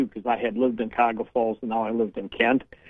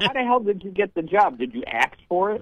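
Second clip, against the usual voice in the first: higher, exasperated jab; mock the advisor's competence; incredulous retort; 7 semitones higher than usual. Caller retelling her scorn at a bad advisor. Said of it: "I was like".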